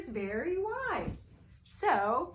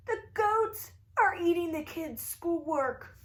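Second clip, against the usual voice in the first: higher, pleading parents; worried parents; 6 semitones higher than usual. Acted. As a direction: complain to the teacher in dismay. Parents complain to teacher.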